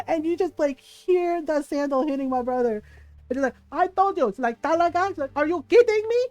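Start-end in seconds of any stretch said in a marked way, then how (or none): 3.7-6.2 high, loud scolding mother; mock angry mom. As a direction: tear into him like a furious mom. Mom scolding son, beating him.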